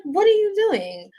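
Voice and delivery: high-pitched annoyed squeal. Retold or imitated. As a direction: squeak it out, exasperated. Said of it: "I'm kind of annoyed".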